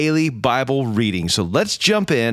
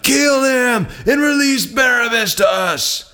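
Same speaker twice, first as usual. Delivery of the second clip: high, slow, drawn-out mob shout; crowd shouting; 11 semitones higher than usual. A narrator's reading. The crowd shouts to Pilate for Barabbas, a high slow shout. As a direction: call it out like a whole crowd demanding blood. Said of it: "with one voice they shouted".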